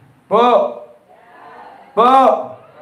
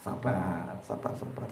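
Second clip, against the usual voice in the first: whispered calm; 11 semitones lower, 18 dB quieter, slower than usual. Shown as a demonstration. Preacher whispering to himself to stay patient. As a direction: whisper sabar to yourself.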